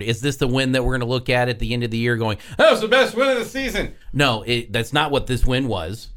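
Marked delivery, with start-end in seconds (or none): 2.6-3.9 high-pitched, hammed-up excitement; mock gushing. Host imitating a look back at the year, mock gushing. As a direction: imitate a gusher in a much higher voice.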